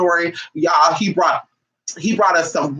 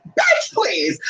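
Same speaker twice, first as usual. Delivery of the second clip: exasperated; 6 semitones higher than usual. Real, not acted. Dismissing lowball payments.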